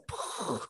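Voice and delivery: soft voiceless puff of air; balloon pop. Retold or imitated. Showing her dream burst like a balloon, puffed out. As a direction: imitate a balloon popping with just breath. Imitates pin popping a balloon.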